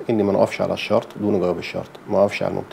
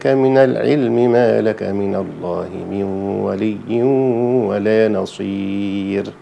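louder tajweed chant, long vowels held six counts; six-count madd recitation; 3 semitones higher, 6 dB louder, much slower than usual. Shown as a demonstration. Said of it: "نجيب الستة بالمرة". (تلاوة بمد ست حركات وصوت أعلى)

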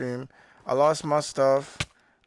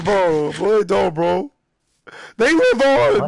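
raised, loud outcry building higher; mock impassioned protest; 7 semitones higher, 8 dB louder, much slower than usual. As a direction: protest with hammed-up grief, rising to a yell. Mock protest that chickens will despawn.

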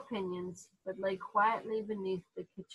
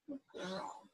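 praise to dog; 2 semitones lower, 15 dB quieter than usual. Real, not acted.

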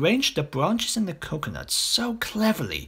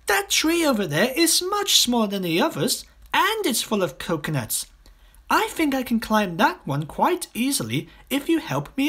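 put-on high, bright-eyed child voice; a small boy keen to climb; eager boy; 10 semitones higher, 3 dB louder than usual. A narrator's reading. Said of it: "cried Toto".

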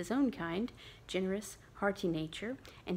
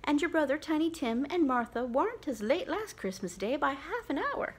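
higher, louder fussing mother; lightly voiced mother, louder; complaining mother; 6 semitones higher, 4 dB louder than usual. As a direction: grumble about the late ones. Mother complaining about lateness.

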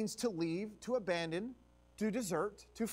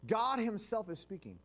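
normal talking voice

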